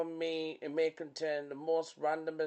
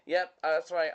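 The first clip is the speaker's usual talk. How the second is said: normal talking voice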